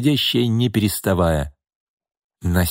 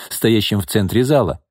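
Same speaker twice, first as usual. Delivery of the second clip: ordinary delivery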